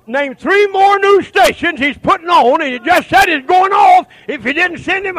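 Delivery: shouting the call to fight; preaching at full force. Acted. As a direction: thunder it out to the congregation.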